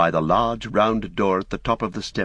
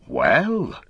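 exclaiming; 5 semitones higher than usual. A narrator's reading. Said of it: "he exclaimed".

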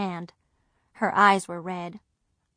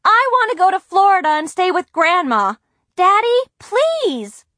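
shouting, pleading; 12 semitones higher, 9 dB louder than usual. A narrator's reading. Girl wails to her father to let her go to Florida. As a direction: beg at the top of her voice. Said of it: "I shouted".

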